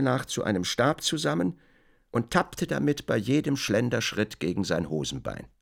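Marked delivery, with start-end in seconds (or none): none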